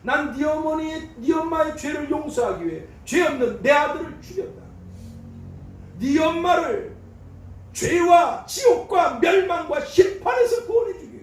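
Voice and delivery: preaching at full force as God's voice; God's thundering rebuke. Acted. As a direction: thunder it down as God rebuking the pastor.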